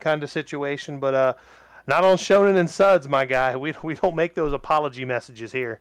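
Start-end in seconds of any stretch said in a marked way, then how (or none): none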